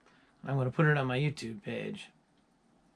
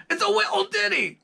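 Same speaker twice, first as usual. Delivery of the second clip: high, loud falsetto Scottish impression, "little ditty" squeezed to "wee-l dinny"; impression of a Scottish singer; 7 dB louder than usual. Mimicking [name] mimicking the Outfield singer, shrill Scottish falsetto. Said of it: "impersonating the singer from the Outfield".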